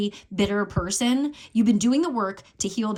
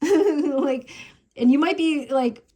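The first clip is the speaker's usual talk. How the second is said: higher and louder, laughing through it; 6 semitones higher, 5 dB louder, slower than usual. Real, not acted. Reader amused by her Twin Flamey card: laughing, higher. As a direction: laugh at the silly card name.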